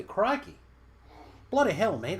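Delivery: high, loud hammed-up Australian exclamations; mock Australian accent. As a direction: blurt out stock Aussie slang in a put-on accent. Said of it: "I should be able to do an Australian accent".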